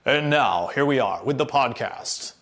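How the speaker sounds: a hint of a radio announcer. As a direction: slide into a lightly hammed radio host intro. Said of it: "he likes to put on his radio voice".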